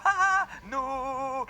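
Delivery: high, drawn-out mock laugh into a long "no"; acted crazed laugh. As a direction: act out losing it, laughing high. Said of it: "je rigole à la fois". (faux rire de dément, aigu et étiré)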